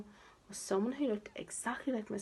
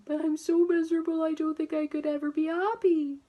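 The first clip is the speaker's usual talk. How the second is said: higher, louder, wailing despair; miserably; 8 semitones higher, 5 dB louder than usual. A narrator's reading. Mister Miserable insists he can never be happy, wailing miserably. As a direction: moan it out as if all is hopeless. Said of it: "he added miserably".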